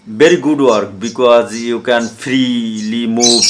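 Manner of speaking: normal talking voice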